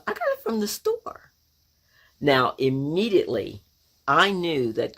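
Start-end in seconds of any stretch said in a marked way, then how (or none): 0.1-1.3 put-on very high, innocent small-child voice; little sister. Little Gail answers mom's question, very high child voice. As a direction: answer mom in a tiny girl's high pitch. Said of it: "And Gail goes".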